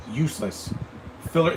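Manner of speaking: ordinary delivery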